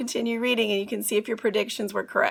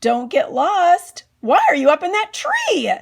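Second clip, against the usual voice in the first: calling out; 5 semitones higher, 7 dB louder than usual. Acted. Mom calls up to Andrew in the tree, loud and higher. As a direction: call up to him in the tree. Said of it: "and then she said".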